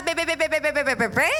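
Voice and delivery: rapid "be-be-be" nagging noise; mock grumbling babble. Pastor mimics someone grumbling at hardship, mock babbling. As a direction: imitate a grumbler's endless babble, flicking up at the end.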